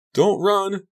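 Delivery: high, loud warning call. A narrator's reading. Jenny warns Donnie as he runs, high loud call. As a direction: call after a running child. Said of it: "Jenny called after him".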